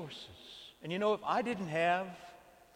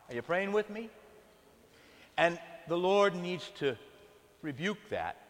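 ordinary talk

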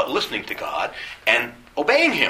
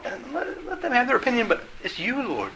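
tender prayer; 11 semitones higher, 7 dB quieter than usual. Acted.

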